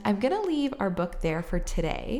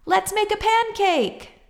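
excited shout; 14 semitones higher, 5 dB louder than usual. A narrator's reading. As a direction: call out proudly with the egg. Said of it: "shouted Jack".

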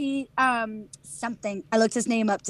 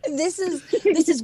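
higher-pitched, giggly delivery; laughing; 7 semitones higher, 3 dB louder, slower than usual. Real, not acted. Host admits a spoiler, laughing. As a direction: let laughter break through the words.

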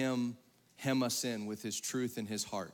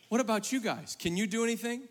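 lightly voiced, higher-pitched pleading woman; 7 semitones higher than usual. Woman turning to the ministry team, pleading. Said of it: "then she goes to the ministry team".

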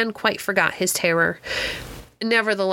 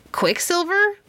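high, puzzled rising echo; surprised question; 10 semitones higher than usual. Acted. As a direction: repeat it in disbelief. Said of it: "repeated Philemon".

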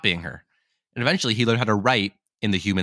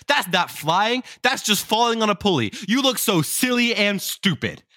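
much higher, sneering taunt in a put-on voice; mocking dogs; 10 semitones higher than usual. Other dogs taunting the would-be dragon, high mocking sneer. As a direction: jeer at him like a schoolyard bully, high and nasty.

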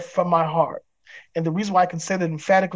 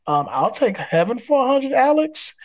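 mock game-show contestant; 6 semitones higher than usual. Jokingly answers as game show contestant.

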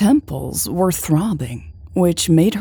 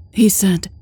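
plain reading voice

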